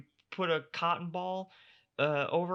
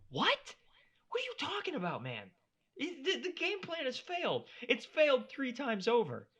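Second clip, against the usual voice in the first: much higher, disbelieving; incredulous; 12 semitones higher than usual. Real, not acted. Host reacting to the corner's advice, incredulous. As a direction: react as if it's absurd.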